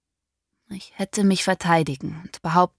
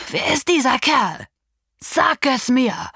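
furious demand; 7 semitones higher, 4 dB louder than usual. Acted. Father demanding his daughter name the man, furious. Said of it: "schrie er mich an".

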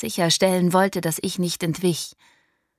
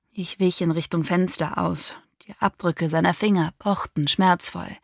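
ordinary delivery

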